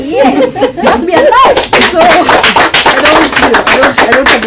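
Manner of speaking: high, loud laughing through the words; real laughter. Real, not acted. Telling a friend about praying for the ex, laughing. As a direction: laugh it out as you speak.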